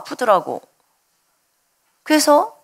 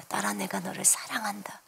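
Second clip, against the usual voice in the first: 숨소리로 속삭이듯 "딸아, 내가 너를 사랑한다"; tender whisper; 3 semitones lower, 15 dB quieter than usual. Retold or imitated. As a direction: whisper it gently, barely voiced. Repeated as whisper. (부드럽게 속삭이는 주님의 음성)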